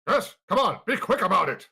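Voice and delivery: loud, high, impatient master; imperious command. Acted. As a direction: hurry the servants along loudly. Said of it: "you hear a deep reverberating voice".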